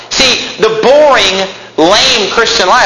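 slow, high stretch on "boring" and "lame"; dragged-out boring. Shown as a demonstration. Preacher mocking the dull safe life, slowly. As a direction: draw out "boring, lame" with disdain. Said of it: "mocking emphasis on boring".

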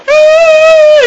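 high, loud mock child's wail; little kid's scream. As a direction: shriek like a startled little kid. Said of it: "I could picture a little kid like".

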